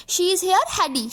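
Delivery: slow, careful reading of the text line; slow dictation read. Shown as a demonstration. Teacher to students, reading the passage slowly. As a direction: read it out slowly for the class to copy.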